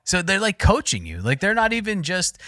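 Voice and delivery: ordinary delivery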